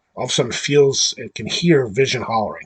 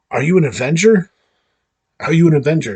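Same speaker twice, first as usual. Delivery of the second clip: mock-alarmed question, raised pitch and louder; retold freak-out; 5 semitones higher, 7 dB louder, faster than usual. Freed woman questions the stranger before her, freaking out. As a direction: ask it in a higher, louder, freaked-out voice. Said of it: "like, freaking out".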